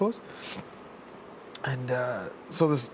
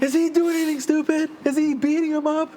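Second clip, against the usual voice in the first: very high mock wife calling out; high-pitched retold shouted question; imitating worried wife; 13 semitones higher, 4 dB louder than usual. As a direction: mimic wife hollering a question to a neighbour.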